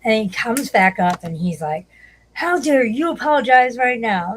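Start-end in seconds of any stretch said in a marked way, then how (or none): 2.3-4.3 high, loud mock outrage; indignant demand. Retelling a panel quarrel, mock indignant demand. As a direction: imitate an angry man demanding an apology. Acting out the angry man's demand.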